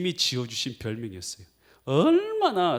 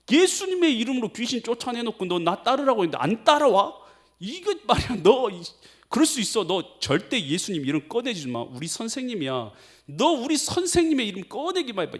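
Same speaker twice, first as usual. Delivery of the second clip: angry scolding; 8 semitones higher than usual. Retold or imitated. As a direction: threaten him in a raised, high voice. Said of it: "좋은 말로 했겠어요".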